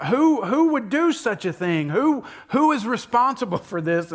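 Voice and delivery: the king raging over the plot against Esther; furious king. Retold or imitated. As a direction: demand an answer like a furious king. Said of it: "the king is furious".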